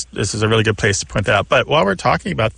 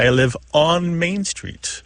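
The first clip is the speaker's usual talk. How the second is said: slow, higher example; 6 semitones higher, slower than usual. Shown as a demonstration. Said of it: "American English speakers might say".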